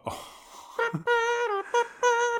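shrieking burst of laughter; high squealing laugh. Real, not acted. Reacting to air trumpet joke.

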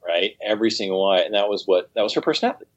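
ordinary delivery

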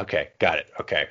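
usual speaking voice

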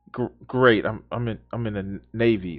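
low, quiet, halting answer; underwhelmed mock reply. Acting the sailor answering the boastful Karen, quietly underwhelmed. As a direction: trail off low and soft, unimpressed.

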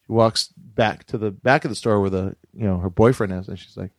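ordinary talk

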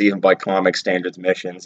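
normal talking voice

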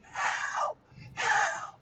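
voiceless, strained imitation of screaming; hushed pretend scream; mock scream. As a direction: fake a scream for help on breath alone. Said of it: "I am screaming, screaming, like".

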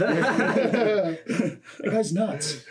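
slow, high laughing line. Real, not acted. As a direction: squeeze it out through laughter. Laughing joke.

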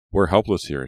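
usual speaking voice

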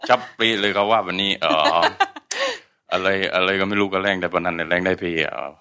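fast Southern Thai self-introduction; Southern Thai dialect. Shown as a demonstration. Introducing himself to a friend in Southern Thai, fast and strong. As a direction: rattle off a greeting in thick Southern Thai. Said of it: "a little intro in, of yourself in Southern Thai".

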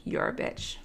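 breathy, near-whispered retort; calling mom a name in a flat hush; cold, hushed insult. Retold or imitated. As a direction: say it low and without voice. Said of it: "I was like".

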